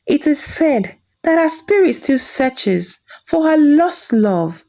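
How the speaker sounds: ordinary delivery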